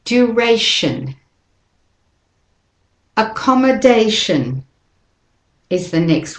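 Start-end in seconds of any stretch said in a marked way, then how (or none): 0.1-1.2 "Duration" slow, stress on ray, weak shun; slow model word. Shown as a demonstration. Teacher invites learners to say it with her, slow. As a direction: model "Duration" slowly for copying. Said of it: "And again".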